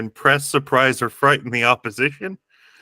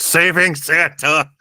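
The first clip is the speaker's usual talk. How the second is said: high, loud battle cry; heroic shout; 7 semitones higher, 5 dB louder than usual. Acted. Player's hero answers the giant's challenge, heroic shout. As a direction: shout it out like a comic-book hero. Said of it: "and shout".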